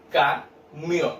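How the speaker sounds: "camión" slowly broken into ca and mión; split into syllables. Shown as a demonstration. Teacher shows children two syllables, split apart. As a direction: leave a gap between ca and mión in "camión". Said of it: "Separo en dos sílabas".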